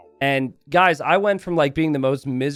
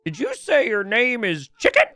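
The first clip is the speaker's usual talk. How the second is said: put-on higher, quavering old caller; old man voice; 8 semitones higher than usual. Old caller mishears the agent's name, high old voice. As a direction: play the confused old man on the phone. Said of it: "There's this old man on the other end".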